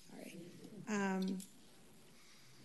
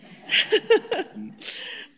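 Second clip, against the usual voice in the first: light laugh; 11 semitones higher, 7 dB louder than usual. Real, not acted. Laughs about complaining at café.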